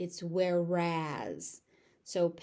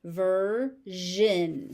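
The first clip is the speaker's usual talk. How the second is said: "Version" split slowly into ver, zhun; stretched teaching model of "Version"; syllable by syllable; 4 dB louder than usual. Shown as a demonstration. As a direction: say "Version" in two separate beats. Teacher breaks word into syllables.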